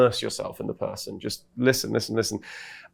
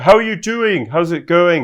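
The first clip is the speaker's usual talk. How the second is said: high, louder awkward greeting; standoffish Louis Theroux; 6 semitones higher, 7 dB louder than usual. Retold or imitated. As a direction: greet a stranger with polite distance. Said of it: "A bit more standoffish like that".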